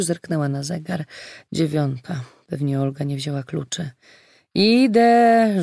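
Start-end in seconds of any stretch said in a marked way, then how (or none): 4.5-5.6 high, loud, long-held call; calling to the door. A narrator's reading. Weronika calls toward the front door, sing-out call. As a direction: call out that you are on your way. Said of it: "rzuciła w stronę drzwi".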